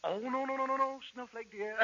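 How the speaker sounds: high, syrupy fussing; hammed-up tender protest; cooing doting husband. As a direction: coo it like an overdoting husband.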